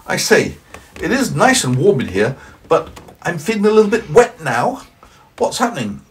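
high, loud cheery snowman. Acted. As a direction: play the indignant snowman, high and bright. Snowman voiced.

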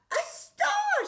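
a high, delighted squeal at a star; excited cry. A narrator's reading. Rocket spotting a star in the dark, delighted high cry. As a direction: shout it out, thrilled at the find. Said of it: "she cried".

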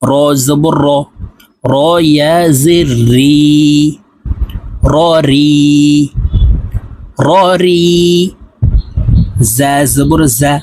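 measured sing-song letter recitation, long final vowels; Quranic spelling drill. Shown as a demonstration. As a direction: chant each spelling for pupils to repeat. (সুর করে টেনে টেনে কায়দার বানান মশক)

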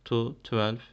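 slow, careful side-by-side numbers; teacher spacing each number out; slow teaching demo. Shown as a demonstration. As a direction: spell out "one, eleven" and "Two, twelve" slowly for students. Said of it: "Benziyor bakın".

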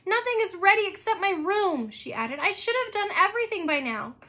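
put-on girl fretting to her father; fretting Abby. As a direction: tumble out the worries like a stressed kid.

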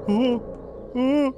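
usual speaking voice

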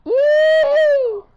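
high, loud drawn-out "yee"; joking whoop. Pretend. Player kidding around with friends, high whoop. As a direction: let out a silly squeal.

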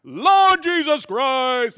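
slow, high bellowed prayer; mock-thunderous, drawn-out praying; very loud deacon praying. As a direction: shout the prayer for all to hear. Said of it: "starts praying over me like this".

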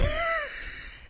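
very high, soft squeal of a laugh; shrieking laugh. Real, not acted. On seeing the villager, a very high squealing laugh. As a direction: squeal in disbelief.

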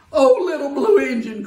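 high, loud cry of the toys; pleading cry. Acted. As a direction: cry out to her in hope. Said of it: "cried the dolls and the toys".

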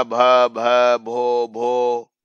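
"भ, भ, भो, भो" stuttered in broken, repeated sounds; stammering. Acted. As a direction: stutter on the first sound of the name. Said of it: "She began to stammer". ("भ, भ, भो, भो" हकलाते हुए)

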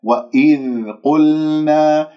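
slow chanted "وإذ قلنا", ذ and ل steady with no bounce; "وإذ قلنا" in measured tajweed, each still letter held cleanly; correct recitation. Shown as a demonstration. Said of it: "هذه أخطاء يجب أن نتجنبها".

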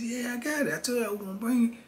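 high and fast; eager answer. Retold or imitated. Narrator tells Mark he brought it, high and quick.

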